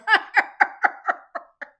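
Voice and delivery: big loud high cackle; loud, breathy burst of laughter. Real, not acted. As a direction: crack up hard at your own joke. Laughs at her own joke.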